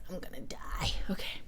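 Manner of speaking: soft, voiceless aside; overwhelmed whisper. Real, not acted. Streamer swamped by comments, whispering in defeat. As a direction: whisper it as if wiped out.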